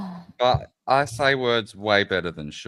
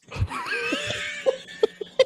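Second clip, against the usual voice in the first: high shrieking laugh. Real, not acted. Hysterical laughing fit.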